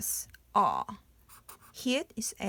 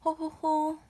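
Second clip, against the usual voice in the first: high dainty ho-ho-ho; ladylike giggle; 6 semitones higher, much faster than usual. Shown as a demonstration. Teacher showing how mom laughs, ladylike giggle. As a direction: giggle like a lady. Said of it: "Laughing sound".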